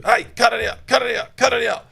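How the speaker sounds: high, loud repeated command; loud stern "cut it out" at a barking dog; sharp correcting shout. Shown as a demonstration. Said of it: "don't just tell it what not to do".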